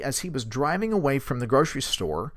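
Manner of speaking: ordinary delivery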